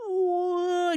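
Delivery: high drawn-out gasp as the bill falls; a stretched mock "ooh" in slow motion. Watching her money drop, a slow mock gasp. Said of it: "like, slow motion".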